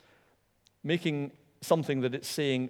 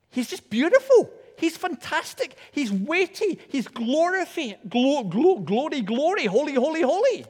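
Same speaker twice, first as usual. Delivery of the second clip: much higher and louder, slow, awestruck gushing; rapturous angels; 10 semitones higher, 3 dB louder than usual. Retold or imitated. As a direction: soar up in wonder as the angels praising God. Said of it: "They're just saying".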